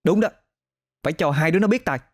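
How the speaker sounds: a touch of scolding solidarity; indignant big sister. Acted. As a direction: back her up with mild indignation. Voices indignant older sister.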